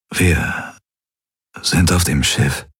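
halting, breath-weak voice; groggy and weak. A narrator's reading. Just-woken patient works out where he is, weakly. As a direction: piece it together slowly.

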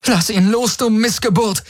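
high-pitched shouted demand; angry call. A narrator's reading. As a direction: call it out high, hurling the insult. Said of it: "rief sie".

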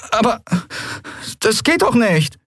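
high-pitched, hesitant protest; helpless dismay. A narrator's reading. Miko protests to the others, helplessly dismayed. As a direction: plead for reassurance in a high voice. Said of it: "Miko sah sich hilfesuchend um".

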